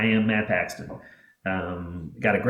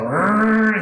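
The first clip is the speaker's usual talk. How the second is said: engine revving; 10 semitones higher, 7 dB louder than usual. Retold or imitated. Grandpa speeding the truck.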